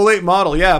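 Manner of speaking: ordinary delivery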